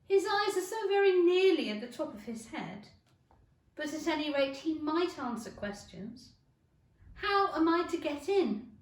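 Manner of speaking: put-on young Alice voice. A narrator's reading. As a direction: voice Alice, exasperated and curious. Alice's voice in story.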